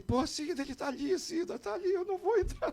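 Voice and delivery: very high, hushed mock panic; panicked whisper-plea. Fan begging his wife at the door, high and hushed. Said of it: "eu disse".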